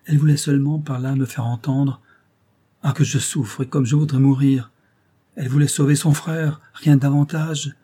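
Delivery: torment yourself aloud over the woman you lost; anguished young lover. Acted. Mohsen alone in the street, despairing over Jemileh, frantic.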